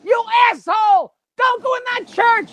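wife shouting at a movie, in a high voice; yelling at the TV. Acted. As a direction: scream at the TV like a horror fan. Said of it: "She's yelling at the screen".

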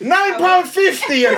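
hammed-up wail down the phone; mock screaming. Friend imitates her complaining about the bill, mock screaming. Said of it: "She's screaming down the market".

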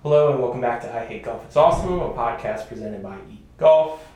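upbeat, fast announcer read; podcast host intro. Acted. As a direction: sell the show opening, loud.

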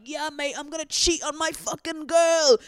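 high, brash British lad voice; mock British lad. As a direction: ham up a cocky British bloke. Mocking boys' cheating boast.

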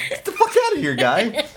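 high, laughing mock outburst; mock exasperation. Retelling shooing a tickling kid away, mock exasperated. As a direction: shoo him off, half laughing.

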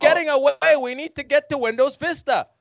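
high, frantic urging; urgent Steve Jobs. Acted. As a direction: push him up high and urgent.

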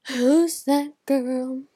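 loud, drawn-out startled question; gasping surprise. Real, not acted. Vlogger suddenly noticing a girl, gasping loudly. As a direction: gasp and stretch it out.

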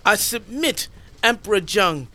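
high, frightened begging; pleading. Acted. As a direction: beg for your life. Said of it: "Zhao Wood says".

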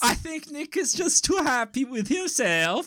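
put-on high, scheming German-accented character; hammed-up villain with a German accent; mock German accent. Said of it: "subconscious Nick would have some kind of".

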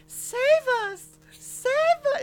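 ghostly plea. Acted. Trapped children crying for help.

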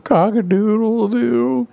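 high, slow drawn-out crow; rooster crow. Retold or imitated. Applejack crowing for herself at the window, high rooster crow. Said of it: "crowing for herself".

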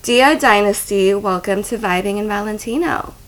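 loud, announcer-style introduction; podcast guest intro. Acted. Host introduces her dominatrix guest to listeners, announcer style. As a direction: present the guest like a show host.

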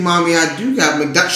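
ordinary talk

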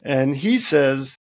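ordinary talk

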